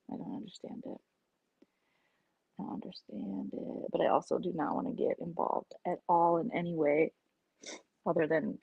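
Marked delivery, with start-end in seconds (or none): 0.1-3.9 breathless unvoiced bafflement; whispered disbelief. Real, not acted. About the flip-flopping, baffled whisper.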